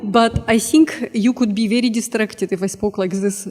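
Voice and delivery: thick Russian accent, held through the whole line. Shown as a demonstration. Lecturer demoing a Russian accent for the audience, thick Russian accent. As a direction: show off your best Russian accent. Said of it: "I can do a very convincing Russian accent".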